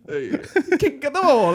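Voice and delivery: high, loud mock Italian accent. Showing her friend a bad Italian accent, high and loud. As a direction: belt it like a cartoon Italian. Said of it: "that's not Italian at all".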